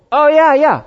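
high, loud burst of mock recognition; excited recognition. American who gets the idiom at once, acted excited recognition. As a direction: jump on it like you know it instantly.

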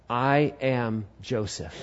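slow, low, soft declaration; hushed, weighty announcement; solemn reveal. Acted. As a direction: reveal yourself quietly, word by word. Acted solemn reveal of identity.

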